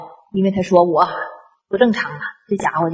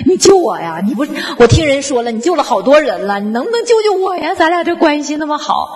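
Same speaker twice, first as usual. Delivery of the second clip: desperate pleading; 6 semitones higher than usual. Retold or imitated. Sick friend begs for help.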